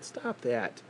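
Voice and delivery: ordinary talk, nothing marked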